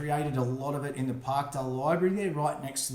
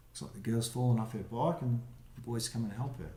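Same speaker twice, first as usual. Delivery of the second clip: plain reading voice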